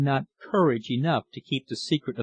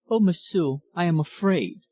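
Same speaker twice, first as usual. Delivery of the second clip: higher-pitched, frightened woman's voice; fearful; 5 semitones higher than usual. A narrator's reading. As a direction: confess fear in a raised, plaintive pitch. Dying woman voices her fear.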